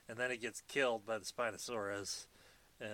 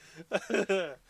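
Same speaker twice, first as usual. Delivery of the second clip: laughing; 8 semitones higher, 7 dB louder than usual. Real, not acted. Laughing after joke.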